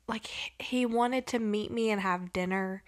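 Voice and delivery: hushed secretive whisper. Real, not acted. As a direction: whisper it like a secret you shouldn't tell. Telling creepy secret, hushed.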